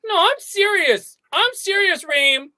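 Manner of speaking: put-on high, whining little boy; whiny kid. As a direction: complain like a small child. Twin child whining at brother.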